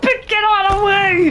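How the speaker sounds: high, loud, desperate order; frantic yell. Real, not acted. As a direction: yell to clear your path. Player blocked by enemy.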